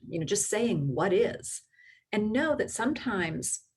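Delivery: ordinary delivery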